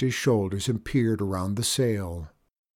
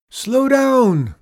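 loud, high, wailing moan; 10 dB louder than usual. A narrator's reading. Mister Bond begging Chester at the tiller, moaning loudly. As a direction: wail it out in protest. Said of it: "moaned Mister Bond".

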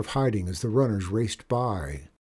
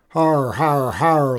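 higher, louder "har har har"; mock gang laughter; 4 semitones higher, 7 dB louder, slower than usual. A narrator's reading. Gang joining the boss's laughter, mock guffaw. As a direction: echo the boss like a jeering gang. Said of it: "laughed his men".

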